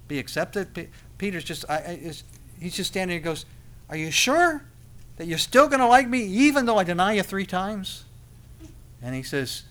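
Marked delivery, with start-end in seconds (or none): 3.9-8.0 high, loud, disbelieving question; incredulous Peter. Acted. Peter asking Jesus despite three denials, incredulous. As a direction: ask it high and insistent, needing to be sure. Acts Peter doubting he is accepted.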